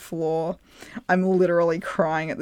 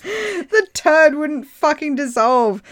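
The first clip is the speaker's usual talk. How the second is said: slow, higher and louder through laughter; laughing through words; 8 semitones higher, 6 dB louder, slower than usual. Real, not acted. Telling the bathtub part, cracking up. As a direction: barely get it out for laughing.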